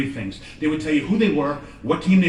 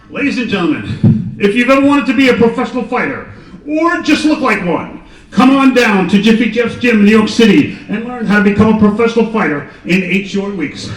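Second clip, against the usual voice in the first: higher, louder ad-announcer hype; infomercial announcer; 5 semitones higher, 10 dB louder than usual. Acted. Announcer introducing Jiffy Jeff's infomercial, loud hype. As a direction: sell it like a TV ad.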